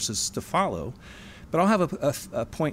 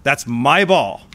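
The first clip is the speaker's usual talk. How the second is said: put-on slow, loud, possessive toddler claiming toys; loud child stressing "my"; selfish child; 8 dB louder, slower than usual. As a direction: whine it like a kid hogging his toys. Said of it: "A little child".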